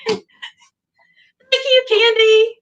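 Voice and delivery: high, slow squeaky thank-you. Real, not acted.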